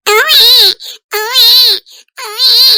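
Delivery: put-on high, loud baby wail; repeated high-pitched bawling cries; baby crying. Lost child being comforted by a woman, crying loudly. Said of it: "ఏడవకు, ఏడవకు బిడ్డ".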